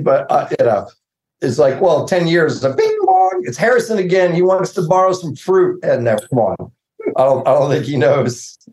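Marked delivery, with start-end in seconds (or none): 2.7-3.4 very high sing-out doorbell imitation; mock doorbell chime. Neighbor acts out a doorbell ringing, high mock chime. 3.5-5.8 high-pitched mock call through the house; acted household call-out. Neighbor announces a famous visitor, high mock call-out.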